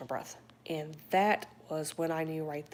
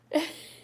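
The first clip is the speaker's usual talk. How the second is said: high laugh; 12 semitones higher, 5 dB louder than usual. Real, not acted. Laughing at a chat comment.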